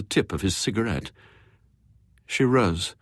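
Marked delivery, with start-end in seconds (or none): none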